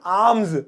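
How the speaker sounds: आर्म्स as "aamz", R left silent. Shown as a demonstration. Teacher modelling it for students, आर्म्स said "aamz" with silent R. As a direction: drop the R in आर्म्स, long open "aa". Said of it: "ऐसे बोल दो".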